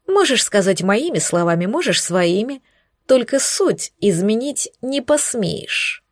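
ordinary delivery, nothing marked

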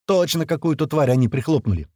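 ordinary delivery, nothing marked

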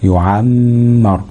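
يعمر drawn out on a humming م; held ghunna. Shown as a demonstration. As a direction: lean long on the م in يعمر. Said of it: "الميم المشددة في وسط الفعل". (يعمر باتكاء طويل على الميم)

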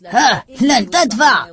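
drawn-out, high-pitched sneer; mocking. A narrator's reading. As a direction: taunt him slowly and loudly. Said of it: "ضحك أحدهم ساخرا".

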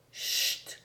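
whispered shushing; low-voiced hush. A narrator's reading. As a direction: hush them, barely audible. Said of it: "dit le charbonnier à voix basse". (chuchotement pour faire taire)

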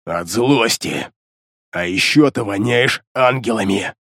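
low, menacing demon. A narrator's reading. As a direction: speak as a sinister demon. Demon Beliar speaks.

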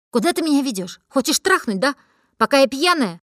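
high, loud accusing yell; yelled in his face. Acted. Drunk girl yells an accusation right in her friend's face. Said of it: "выкрикнула я ему в лицо".

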